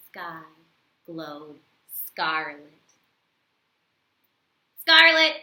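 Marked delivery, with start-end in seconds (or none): 4.8-5.3 loud, high burst on the new word; excited exclaim. Acted. Teacher to children, thrilled by the new word. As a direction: announce the word with delight.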